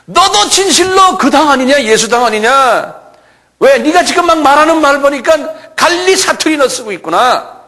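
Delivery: high, loud, pointed accusation; accusing shout. Acted. Bystanders accuse Peter over his dialect, high accusing shout. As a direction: press him loudly, pitch held high.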